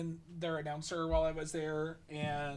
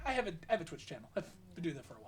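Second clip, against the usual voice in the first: rushed excuse; 4 dB quieter, much faster than usual. Retold or imitated. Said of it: "And I'm like".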